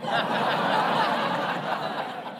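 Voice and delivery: soft, airy high laugh; breathy laugh. Real, not acted. Laughs at punchline.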